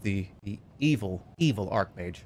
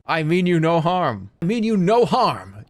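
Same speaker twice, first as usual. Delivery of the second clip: hasty reassurance; 6 semitones higher, 5 dB louder than usual. Acted. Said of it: "you reply quickly".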